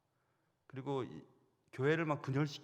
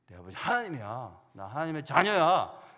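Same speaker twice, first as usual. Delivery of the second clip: bold declaration; 8 dB louder than usual. Pretend. Preacher answering the demon, firm and proud. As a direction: stand tall and claim it. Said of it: "그렇게 하려고 딱 준비하고".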